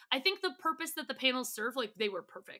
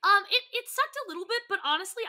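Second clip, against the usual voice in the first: excited; 10 semitones higher, 6 dB louder than usual. Real, not acted. Echoing with high excited voice.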